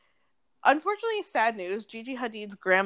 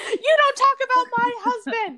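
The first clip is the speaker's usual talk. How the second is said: high laughing mock shriek; shrill overlapping reality fight; 7 dB louder than usual. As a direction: yell it through laughter, reality style. Reenacting Lisa Rinna's line.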